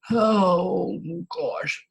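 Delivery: loud, high drawn-out groan of dismay; exasperated. Real, not acted. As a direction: let out a long, despairing oh. Exasperated after acting out quarrel.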